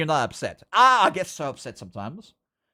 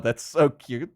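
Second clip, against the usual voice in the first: ordinary talk, nothing marked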